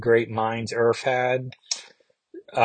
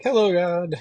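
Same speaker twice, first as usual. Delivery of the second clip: cheerful greeting; 6 semitones higher than usual. Retold or imitated. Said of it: "waves happily".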